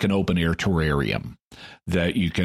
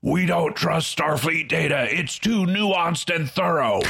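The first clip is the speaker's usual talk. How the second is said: high, strained alien captain; mock indignant alien warrior, slowing at the end; 9 semitones higher than usual. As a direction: play a belligerent alien captain, pitched way up. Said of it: "the Chalnoth captain says".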